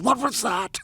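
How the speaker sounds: mock alarm; fake startled blurt. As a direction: jolt as if you heard something. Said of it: "I would blurt out".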